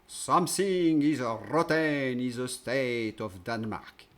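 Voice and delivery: put-on slow, loud theatrical English with a French accent. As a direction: declaim Shakespeare in English with a heavy French accent. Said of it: "Pardon pour mon accent". (anglais solennel avec un fort accent français)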